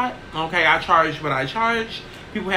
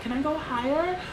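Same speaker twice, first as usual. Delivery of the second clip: going even higher; 5 semitones higher than usual. Shown as a demonstration. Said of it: "I'm really supposed to be talking like this".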